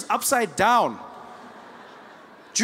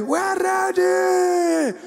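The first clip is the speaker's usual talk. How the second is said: crowd shouting back; 11 semitones higher, 4 dB louder, much slower than usual. Retold or imitated. Crowd answers at rally.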